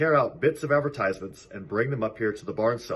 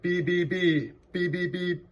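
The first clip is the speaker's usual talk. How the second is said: high, tiny peeping chirps rising much higher midway; peeping gooselings; 10 semitones higher, 3 dB louder than usual. A narrator's reading. Gooselings answering the roll call, high peeping chirps. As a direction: cheep it like baby geese. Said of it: "said the gooselings".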